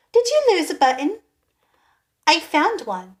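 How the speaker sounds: high, quick little bird voice; a small bird, eager and quick; chirpy sparrow. Acted.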